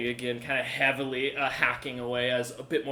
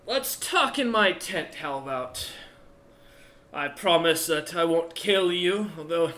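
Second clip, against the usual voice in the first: put-on old dwarf chieftain; 6 semitones higher, 7 dB louder than usual. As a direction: taunt them like a battered old warrior. Odger keeps talking.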